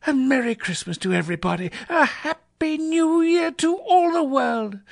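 very high, euphoric Scrooge; giddy elation. A narrator's reading. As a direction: crow with joy at the top of your range. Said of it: "laughing and crying in the same breath".

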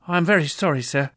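a nervous clerk pleading with his boss; timid Bob apologising for being late; anxious clerk. Acted.

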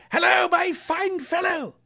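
high, hearty call; hailing the boy. A narrator's reading. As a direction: hail someone below, jovial and high. Scrooge hails the boy below.

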